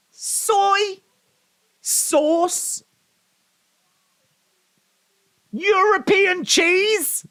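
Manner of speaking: very high, laughing squeal; squealing laughter. Real, not acted. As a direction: squeal through fits of laughter. Laughing fit over flavors.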